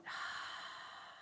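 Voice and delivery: long unvoiced out-breath sigh. Shown as a demonstration. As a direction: breathe out in a slow audible sigh. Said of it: "and then sigh out".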